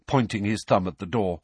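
usual speaking voice

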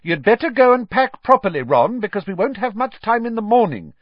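high, loud motherly order; calling out. A narrator's reading. Mother calling down the table to Ron, loud. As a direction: project it down the table. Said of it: "called down the table".

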